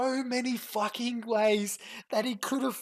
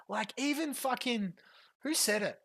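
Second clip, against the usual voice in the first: usual speaking voice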